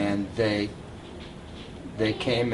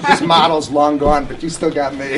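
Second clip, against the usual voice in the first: louder, laughing through a teasing jab; laughing tease; 3 semitones higher, 8 dB louder than usual. Real, not acted. Teasing his friend to laughter.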